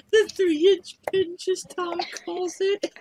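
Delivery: high-pitched, laughing through the words. Real, not acted. Teasing Kim with Tom's nickname, laughing. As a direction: squeal it out mid-laugh. Cracking up at a crude joke.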